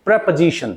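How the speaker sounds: "प्रपोजिशन" as preh-puh-ZI-shun; weak "puh" in the middle of "प्रपोजिशन", stress on "ZI"; correct pronunciation. Shown as a demonstration. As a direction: model "प्रपोजिशन" with a reduced middle vowel. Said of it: "So आप इसको बोलोगे".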